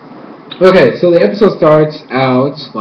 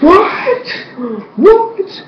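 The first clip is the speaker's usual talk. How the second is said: much higher, squeaky mock disbelief; shocked crowd; 13 semitones higher than usual. Crowd hearing Korra's accusation, mock shocked what. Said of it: "And everybody's like".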